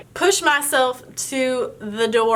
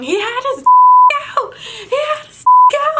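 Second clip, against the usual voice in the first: high, louder stunned repeating with laughter; shocked niece repeating; 10 dB louder than usual. Retold or imitated. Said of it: "My niece keeps saying".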